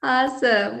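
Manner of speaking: ordinary delivery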